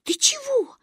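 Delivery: put-on very high-pitched little girl voice; startled child. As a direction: squeak it in childlike surprise. Friend startled in acted quarrel.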